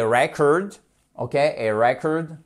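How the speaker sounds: noun "record" stressed RE-cord; first-syllable stress. Shown as a demonstration. Teacher contrasting noun and verb, record stressed up front. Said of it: "regardez à quel point c'est important".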